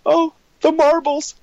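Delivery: very high, tiny sentimental squeal; touched mock reaction. Teacher finding marbles in jacket pocket, touched high squeal. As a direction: coo it way up in falsetto. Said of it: "I was like".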